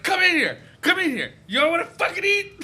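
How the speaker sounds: high, loud, mock commanding; bossy drunk mom. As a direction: summon the kids way up high and loud. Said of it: "She's like".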